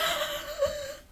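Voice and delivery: high, thin mock wail, kept soft; imitated crying wail. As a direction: mimic her trademark cry, high and drawn-out. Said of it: "she's crying as she's running".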